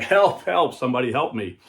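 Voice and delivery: loud, high cries for help; acted shout. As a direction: yell for rescue as the trapped man. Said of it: "he started shouting out".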